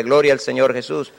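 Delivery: ordinary talk, nothing marked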